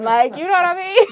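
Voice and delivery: high, loud, laughing question. Real, not acted. Admitting sin is fun, laughing loudly. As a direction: giggle through it.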